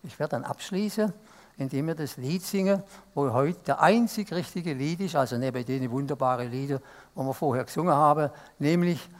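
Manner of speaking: ordinary talk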